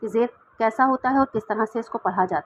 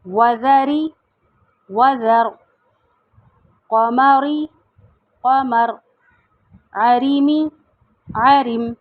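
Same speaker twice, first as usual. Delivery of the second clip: word drill; much slower than usual. Shown as a demonstration. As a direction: drill the pairs: full then paused. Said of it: "جیسے ای بی لی". (لفظ جوڑوں کی مشق، زور سے)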